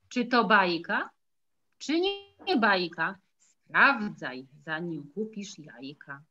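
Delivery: lively rhythmic poem reading; animated children's verse. Acted. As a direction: bounce through the rhymes for the kids. Reads second poem to children.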